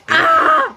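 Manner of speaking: high squeal of delight at the taste. Real, not acted. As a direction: let out a sudden high yelp of pleasure. Reacts to hot food. (taas nga singgit sa kalipay)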